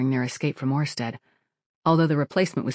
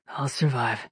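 grim humor; 2 semitones lower, slower than usual. A narrator's reading. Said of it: "with a certain amount of grim humor".

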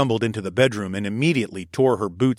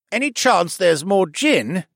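higher, louder slow call across the room; 9 semitones higher, 5 dB louder, slower than usual. A narrator's reading. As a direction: call over from a booth. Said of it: "Roger called from his booth".

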